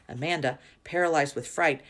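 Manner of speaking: usual speaking voice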